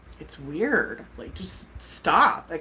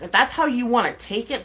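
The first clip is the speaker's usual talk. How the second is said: usual speaking voice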